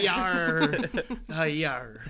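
loud laughing pirate yar; hammed-up pirate cry. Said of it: "my pirate voice guy".

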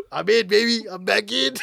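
loud, high, laughing hype; excited loud quote. Retold or imitated. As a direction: shout it like a returning fan. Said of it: "He's like".

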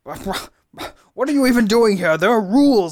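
high-pitched, loud, flustered clock character; stammer, then insist in high outrage; indignant fussing. Acted. Grabbed clock lectures intruder on rules, high indignant fussing.

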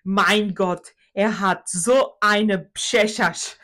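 loud, drawn-out mock German exclamation; father's German accent. Grandfather admires grandson's cheeks, father's German accent. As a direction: imitate German dad marvelling, loudly hammed up. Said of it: "para o meu filho e diz".

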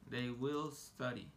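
"They will study" spoken very slowly; careful teacher demo; slow model. Shown as a demonstration. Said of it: "teacher models English slowly".

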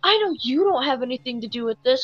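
lightly hammed-up agitated protest; retold yelling. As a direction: imitate a customer insisting through his temper. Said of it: "you're yelling at me".

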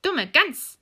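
high, loud witch insult; scolding witch. Acted. Witch irked by Gretel's question, loud scolding. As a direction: snap it loudly at the silly girl. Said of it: "sagte die Alte".

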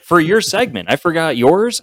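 high-pitched exasperated admission; worked up at yourself. Real, not acted. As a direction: own up in high-voiced dismay. Exasperated at own repeated forgetting.

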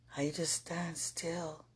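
slow hushed whisper; soft reverent whisper. Real, not acted. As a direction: breathe the words out, slowly. Repeats the phrase, now whispered.

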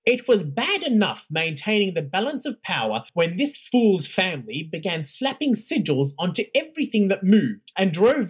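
cold imperious lecture to the faculty; stern imperious Roth. Acted. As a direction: talk down to the room from on high. Narrator voicing Roth's tirade.

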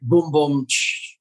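rimshot boom boom tss; drum sting sound effect. Pretend. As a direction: mouth a comedy drum sting. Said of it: "can I just give a sound effect".

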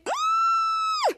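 put-on very high, shrill-pitched girlish shriek; mock high scream; girl's scream. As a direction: shriek like a frightened teen girl. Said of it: "the girl's screaming".

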